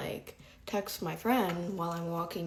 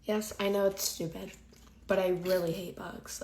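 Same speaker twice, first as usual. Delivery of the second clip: normal talking voice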